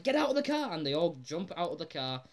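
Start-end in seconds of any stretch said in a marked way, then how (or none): 0.0-0.7 much higher, louder mock panicked yell; hammed-up order to bail out; urgent yell. Lisa yelling at family to escape car, mock panicked shout. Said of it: "And Lisa's like".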